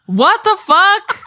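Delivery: shrieking what the fuck in shock; stunned disbelief. Real, not acted. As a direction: drag out the disbelief. Shocked he is from Anaheim.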